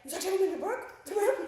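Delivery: shrill mock outrage, rising on Brooke; mock angry mom. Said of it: "And then she's like".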